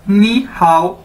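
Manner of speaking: नी हाउ as one greeting, both words clear; नी हाउ modelled for learners; Chinese nǐ hǎo. Shown as a demonstration. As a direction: say नी हाउ together like a hello. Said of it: "pronunciation भी लिखी है नी हाउ".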